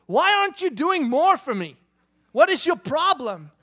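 put-on high, loud demanding child voice; whiny kid. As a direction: play the kid griping at dad.

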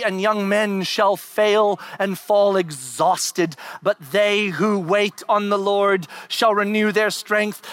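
preaching at full force. Acted. Pastor proclaiming Isaiah to congregation, full-force preaching. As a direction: thunder the verse out.

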